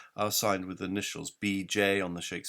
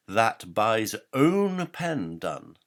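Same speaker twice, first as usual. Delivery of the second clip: "own" leaned on, the whole line slow and loud; stress on own; 3 semitones higher, 8 dB louder, much slower than usual. Shown as a demonstration. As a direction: hit "own" hard and draw the line out. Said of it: "to put that stress on the word own".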